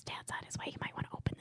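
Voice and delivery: secretive whispered warning. Retold or imitated. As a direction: lean in and whisper the tip-off. Sister slipping him the wine.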